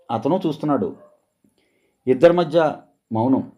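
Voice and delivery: plain reading voice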